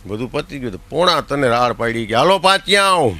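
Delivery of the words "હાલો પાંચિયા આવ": a loud, higher call across the wedding crowd. Retold or imitated. Host shouting for the five-rupee guests, loud call. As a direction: yell it out like summoning the guests to eat.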